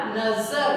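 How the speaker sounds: नजर with a long audible z buzz; buzzing z demo. Shown as a demonstration. Teacher pointing out the sound, नजर with buzzing z. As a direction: let the z in नजर ring out. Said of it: "आवाज आ रही है".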